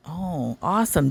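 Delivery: normal talking voice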